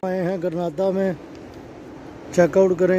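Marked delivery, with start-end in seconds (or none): none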